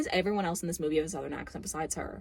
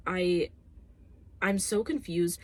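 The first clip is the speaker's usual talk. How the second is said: usual speaking voice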